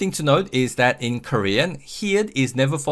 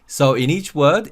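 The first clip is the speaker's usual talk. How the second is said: usual speaking voice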